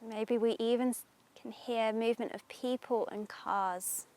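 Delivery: guided meditation. Acted. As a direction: ease them into calm, unhurried.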